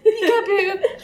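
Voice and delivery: high peekaboo. Acted. Playful peekaboo to friend.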